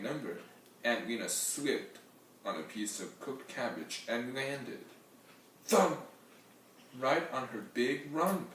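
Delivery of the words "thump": thump. A narrator's reading. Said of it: "narrator sound effect".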